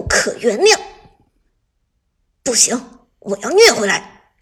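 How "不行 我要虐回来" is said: loud, grinding-teeth vow; through gritted teeth. Acted. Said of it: "陈烨咬牙切齿地说".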